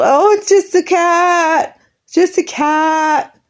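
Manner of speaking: high, slow, dismissive mimic; dismissive mock. Imitating family dismissing her dead cat, mock dismissive. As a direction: brush it off mockingly. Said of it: "I was told".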